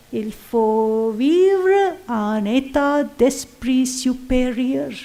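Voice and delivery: slow, careful French "Il faut vivre en état d'esprit supérieur"; French quote. Retold or imitated. Teacher quoting the Mother in French, slow and careful. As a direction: give the French words slowly and clearly. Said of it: "She would say in French".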